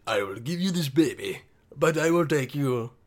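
lightly voiced Indian-accented God; a hint of an Indian accent for God's deal; mock Indian God. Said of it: "I thought God is Indian".

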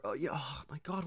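whispered, half-voiced panic; whispered mock panic; panicked whisper. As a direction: whisper it in a fluster. Mom panicking.

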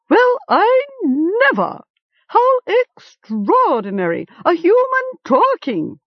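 high, squawking raven, amazed; a bird gasping at a talking human; astonished raven. A narrator's reading. Startled raven exclaiming at the talking boy.

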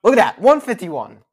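mock excited.

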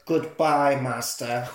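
low, fading servant voice; a lightly voiced creepy servant signing off; Igor voice. Retelling the game, Igor bids his master goodbye, low. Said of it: "Igor says".

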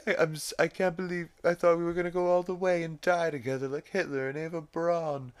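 high, rushed mock Stewie lament. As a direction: rattle it off high and fast like the baby. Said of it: "he's like".

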